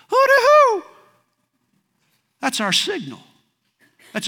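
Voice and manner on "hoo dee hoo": high, loud yard holler; piercing sing-out call, much higher and louder; shouted family call. Shown as a demonstration. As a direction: holler it out to call the boys home. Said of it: "I'll shout".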